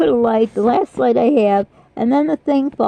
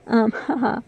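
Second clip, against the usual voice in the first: normal talking voice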